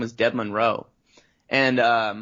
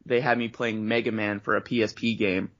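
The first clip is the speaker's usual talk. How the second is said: normal talking voice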